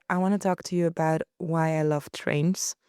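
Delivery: ordinary talk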